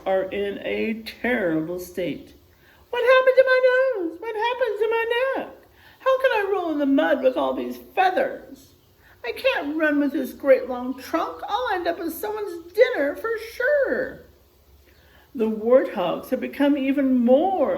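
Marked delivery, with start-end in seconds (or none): 2.9-5.5 very high, loud, fast panic; panicked warthog. Acted. Warthogs in terrible state. 6.0-14.2 high, wailing complaint; distressed warthog. Acted. Warthogs in terrible state.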